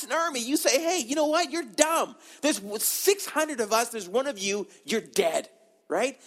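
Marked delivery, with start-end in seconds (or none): 0.8-5.5 high-pitched mock put-down; scornful retort. Army soldier answering a lone attacker, scornful. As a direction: tell off a foolish attacker.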